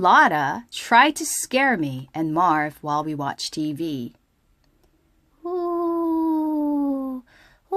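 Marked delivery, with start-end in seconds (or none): 5.5-7.8 ghost ooh. Acted. Ghostly noise in story.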